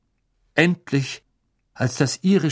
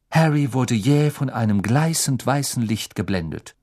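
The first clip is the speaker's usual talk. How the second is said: ordinary delivery, nothing marked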